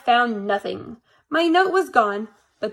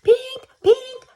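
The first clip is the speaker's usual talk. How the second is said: high, loud beeping alarm; 12 semitones higher, 4 dB louder than usual. A narrator's reading. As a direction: chirp it like an alarm clock. Alarm clock sound imitated.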